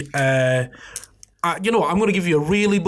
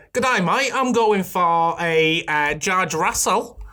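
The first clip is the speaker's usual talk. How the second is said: bad Australian accent. Co-host giving his pole pick on request, bad Australian accent. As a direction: put on a hammy, badly faked Australian accent. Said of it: "In your best Australian accent".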